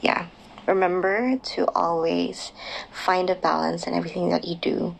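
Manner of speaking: ordinary talk, nothing marked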